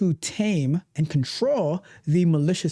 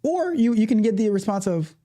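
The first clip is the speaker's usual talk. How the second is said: ordinary delivery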